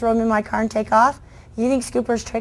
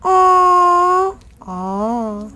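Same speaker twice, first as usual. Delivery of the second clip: put-on kids going aww; 7 semitones higher than usual. Shown as a demonstration. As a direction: imitate the whole audience melting over a puppy. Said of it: "making kids do this".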